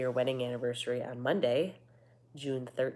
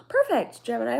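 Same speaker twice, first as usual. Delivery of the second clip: excited; 9 semitones higher, 9 dB louder than usual. Real, not acted. Gasping delight.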